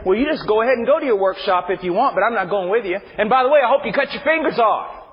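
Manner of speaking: mock cold, petty God; lightly voiced spiteful deity; a touch of mocking sarcasm; sarcastic harsh God. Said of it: "God's gonna stand there and say".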